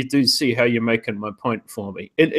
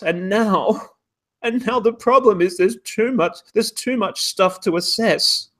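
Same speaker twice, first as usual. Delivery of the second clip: high-pitched, worked-up exasperation; mock outrage; 8 semitones higher than usual. Host parodies academic bosses banning junior opinions, mock outrage. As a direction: wind up into a high, indignant rant breaking into laughter. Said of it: "I'll get angry about this".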